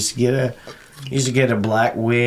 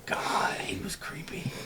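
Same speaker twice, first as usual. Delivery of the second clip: whisper; 9 semitones higher, 12 dB quieter than usual. Real, not acted. Creepy memory aside.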